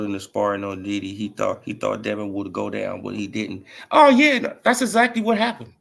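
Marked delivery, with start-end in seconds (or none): 3.9-5.7 excited agreement. Real, not acted. Eager agreement.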